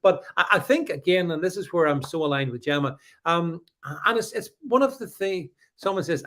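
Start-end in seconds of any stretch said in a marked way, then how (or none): none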